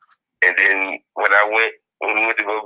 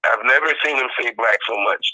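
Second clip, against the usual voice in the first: ordinary talk, nothing marked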